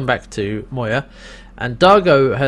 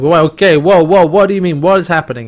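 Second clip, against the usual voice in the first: mock alarmed, higher and louder; 4 semitones higher, 6 dB louder than usual. Crichton startled by Pilot, alarmed and louder. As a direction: caught off guard, talk over him.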